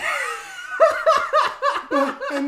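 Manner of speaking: high loud shriek of laughter; loud high laughing shriek. Real, not acted. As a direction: scream with laughter. Laugh shout.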